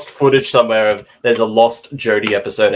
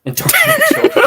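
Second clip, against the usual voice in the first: laughing. Pretend. Echoing joke, laughing high.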